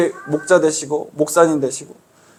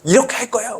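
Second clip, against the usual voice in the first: higher-pitched imitated scolding; mock angry outburst; 7 semitones higher than usual. Outsider telling off a worker at their workplace, mock angry. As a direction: briefly play someone losing their temper. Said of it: "막 화내면서".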